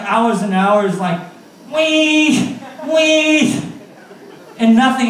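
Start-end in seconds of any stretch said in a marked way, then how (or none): 1.7-3.6 high, light mock goose call; whistle imitation. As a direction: mimic the whistle calling the geese.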